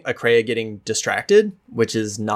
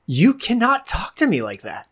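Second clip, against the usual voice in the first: higher, sharper repeat with cannot stressed; indignant; 9 semitones higher than usual. Acted. Akreya repeating her warning to Marin, higher and sharper. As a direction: spell it out, offended. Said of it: "repeats the line harder".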